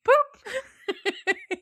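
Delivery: loud, squeaky, drawn-out "boop"; high-pitched boop. Retold or imitated. High brother boops his brother's nose, loud squeaky silliness. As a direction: imitate a silly nose-poke noise way up high. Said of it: "Sam goes".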